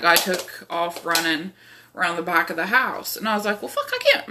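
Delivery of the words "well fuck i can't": much higher well fuck I can't; panicky squeak. Retold or imitated. Panicked refusal to enter basement.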